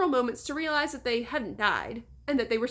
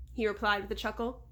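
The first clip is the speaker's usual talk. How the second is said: ordinary delivery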